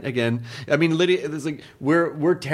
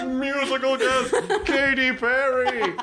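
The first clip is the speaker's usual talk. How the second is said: high, drawn-out announcer call; show announcer; 11 semitones higher, slower than usual. Acted. Host announcing a nightmare wedding's musical guest, mock fanfare. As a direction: introduce the act with mock fanfare, slowly.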